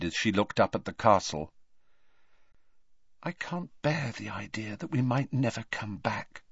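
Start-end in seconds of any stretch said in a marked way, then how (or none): 3.2-6.4 a soft, sad voice, a little quieter; softly. A narrator's reading. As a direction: say it gently, fearing the loss of school. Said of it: "she said softly".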